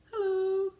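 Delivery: very high, loud squeaky toy bear; teddy bear voice. Pretend. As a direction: make the bear say hi in a tiny high voice. Teddy bear Gary greets children.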